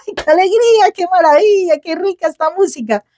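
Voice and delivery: high, gushing squeal of delight; over-the-top pretend rapture; mock overjoyed. Mock joy at sad music.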